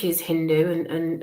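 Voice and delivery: ordinary talk, nothing marked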